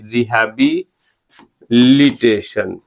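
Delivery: Rehabilitation slow, pausing before लिटेशन; syllable by syllable. Shown as a demonstration. Teacher showing how the spelling divides, rehabilitation slowly in parts. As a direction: split rehabilitation slowly into two drawn-out halves. Teacher breaking word up to memorise.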